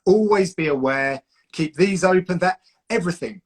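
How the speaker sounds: ordinary talk, nothing marked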